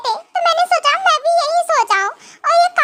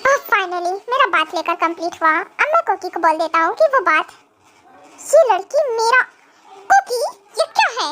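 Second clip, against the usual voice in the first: grumpy husband; 6 semitones lower than usual. Acted. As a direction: lower stern husband voice. Husband figure held voice. (नाराज़ पति, हल्की नीची आवाज़)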